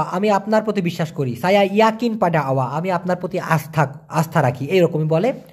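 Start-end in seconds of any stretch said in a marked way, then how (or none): none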